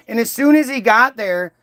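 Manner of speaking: usual speaking voice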